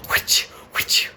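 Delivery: whispered taunt. Acted. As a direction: breathe it as a mocking whisper. Whispered aside again.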